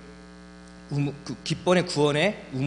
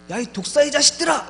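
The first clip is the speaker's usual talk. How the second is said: loud, high, cutting cry at the crowd; shouting rebuke; 11 semitones higher, 6 dB louder than usual. Retold or imitated. John the Baptist rebuking those coming for baptism, loud shout. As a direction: hurl the rebuke like a prophet crying out. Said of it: "이렇게 외칩니다".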